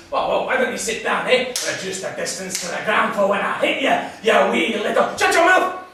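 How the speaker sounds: loud threat building to a high shout; combative, boiling over; angry threat. Acted. Threat in a quarrel.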